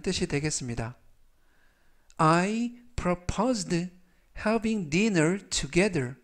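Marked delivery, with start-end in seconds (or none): 2.2-6.2 very slow, word by word; 아주 천천히 또박또박 읽기; slow reading. Shown as a demonstration. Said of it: "천천히 세 번씩 읽어드리고요". (따라 하도록 천천히 끊어 읽기)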